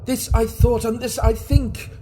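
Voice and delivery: from tense telling to a high, loud, frantic cry; mounting frenzy. Acted. Murderer hearing the heart before the police, building from urgent to frantic high shouting. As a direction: let the panic climb until he is nearly screaming.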